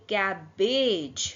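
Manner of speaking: very slow "कैबेज", ending on a j sound; soft G word. Shown as a demonstration. Teacher reading out a soft G word, slow demonstration. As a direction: stretch out "कैबेज" syllable by syllable. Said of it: "उसको भी ज बोलेंगे".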